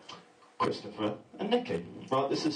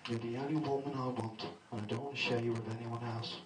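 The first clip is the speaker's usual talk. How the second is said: mock sincere; 3 semitones lower, 8 dB quieter than usual. Sincere boyfriend reply.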